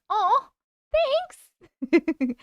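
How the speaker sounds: high sweet Disney princess voice; cartoon princess.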